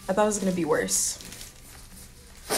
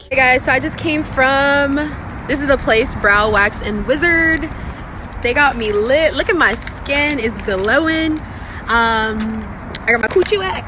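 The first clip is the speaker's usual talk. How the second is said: much louder, bubbly and thrilled; excited; 4 semitones higher, 8 dB louder than usual. Real, not acted. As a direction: gush to the camera about your glow-up. Excited vlog recap after waxing.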